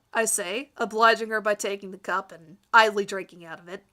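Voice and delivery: plain reading voice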